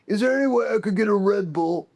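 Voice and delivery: high, loud mock rock-star request; hammed-up high-voiced impression. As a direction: pitch it way up, imitating a needy guest. Imitating [name]'s drink request.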